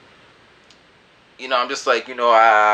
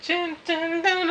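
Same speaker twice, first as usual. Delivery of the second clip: much higher, chanted beat sounds; crowd grooving imitation; 5 dB quieter than usual. The whole crowd loving the song, a high chanted beat. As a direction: bob along like the crowd. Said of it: "the whole crowd was just like".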